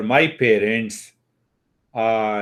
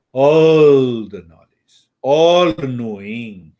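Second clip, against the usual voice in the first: ALL stretched out, louder and slow; solemn teacher driving the point home; slow emphatic repeat; 5 dB louder, slower than usual. Shown as a demonstration. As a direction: lean on all the knowledge, drawing it out.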